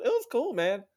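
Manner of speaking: normal talking voice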